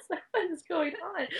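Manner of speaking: high, soft, slow words through a laugh; half-laughing at the crowd's sirens, bewildered; laughing disbelief. Retold or imitated. To the host, recalling her baffled reaction, laughing softly.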